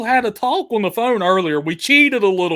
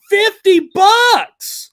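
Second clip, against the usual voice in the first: excited; 10 semitones higher, 7 dB louder, much slower than usual. Real, not acted. Donation announced on stream.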